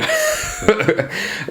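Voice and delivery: high, giggly chuckle; short burst of laughter. Real, not acted. Laughs at own demo.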